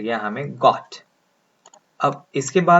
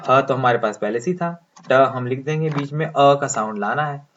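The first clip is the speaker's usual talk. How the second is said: ordinary delivery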